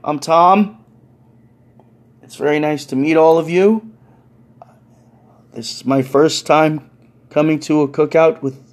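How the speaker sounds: halting, careful politeness with long pauses; very polite. Retold or imitated. Newcomer Tom greeting everyone at the cookout, very politely. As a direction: address the crowd ever so politely. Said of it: "very politely and goes".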